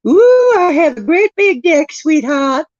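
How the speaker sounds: a high, loud mock flirt; flirty high voice. Showing off her new deck to chat, mock flirty high voice.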